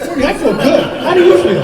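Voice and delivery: high, giddy and laughing, wired on pills; hyped-up buzz. Retold or imitated. To his teammate before the race, giddy wired high voice. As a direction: sound like you've had way too much caffeine.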